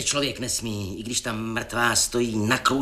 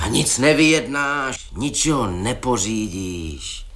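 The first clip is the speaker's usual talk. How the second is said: usual speaking voice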